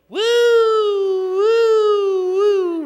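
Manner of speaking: high, loud drawn-out woo; long wailing wind imitation; wind howl. Shown as a demonstration. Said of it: "hands up in the air and go".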